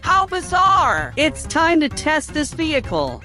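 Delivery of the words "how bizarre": slow, high, drawn-out amazement; amazed. Real, not acted. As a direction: stretch it out in disbelief. Car driving on its own.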